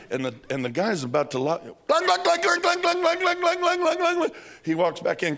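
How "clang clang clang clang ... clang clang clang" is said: high, loud ringing clangs; clanging imitation. Teller mimicking pipes clanging like alarm bells.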